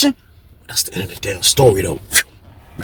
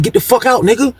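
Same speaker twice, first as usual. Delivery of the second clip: higher barked order; voicing Ram ordering; 11 semitones higher than usual. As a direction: order him out of the cell.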